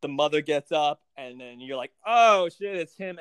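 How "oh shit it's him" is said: louder, higher startled oh shit; shocked. Retold or imitated. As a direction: blurt it out in shock. Reacting to shocking scene.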